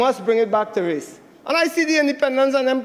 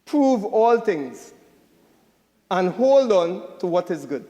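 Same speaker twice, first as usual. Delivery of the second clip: slow, solemn reading of a verse; scripture reading; 4 semitones lower, slower than usual. Retold or imitated. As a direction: read the verse slowly, like in church. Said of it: "what I read this morning was".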